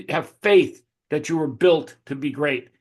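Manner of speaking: ordinary delivery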